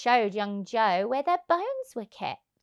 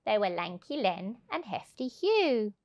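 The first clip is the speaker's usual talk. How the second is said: ordinary delivery, nothing marked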